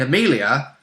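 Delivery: normal talking voice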